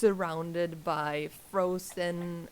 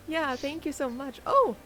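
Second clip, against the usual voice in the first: ordinary talk, nothing marked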